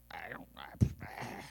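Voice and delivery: very soft, halting and unsure; hesitant mumbled reply. Acted. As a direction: fumble for an answer under your breath. Voices disciples' hesitant reply.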